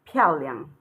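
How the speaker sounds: "piaoliang" falling sharply, then a short, abrupt second syllable; falling tone, neutral tone. Shown as a demonstration. Teacher showing students how a word is pronounced, falling then abrupt neutral tone on "piaoliang". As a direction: say "piaoliang" with piao dropping and liang cut short and toneless. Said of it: "this is falling tone, and here you pronounce it abruptly".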